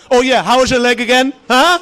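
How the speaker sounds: lightly voiced scornful immigrant parent. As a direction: needle them, pushing up on the huh. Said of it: "you know, the immigrant".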